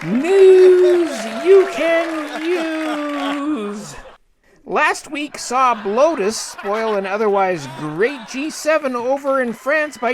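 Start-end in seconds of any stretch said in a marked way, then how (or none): 0.0-3.9 very drawn-out, higher title call; segment title announcement. Acted. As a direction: stretch each word into a grand segment title.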